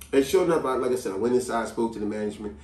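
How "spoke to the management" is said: usual speaking voice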